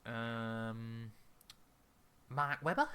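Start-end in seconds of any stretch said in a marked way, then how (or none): 2.3-2.9 bad Australian accent, lightly done; a hint of an Aussie twang on Mark Webber. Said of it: "That was a hideous accent".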